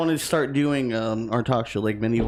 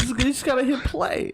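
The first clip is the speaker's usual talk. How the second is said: much higher, laughing impression; Christopher Walken; 11 semitones higher than usual. Co-host quoting the film, laughing celebrity impression. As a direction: squeak out the line through laughter. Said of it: "So is Christopher Walken getting laid off?".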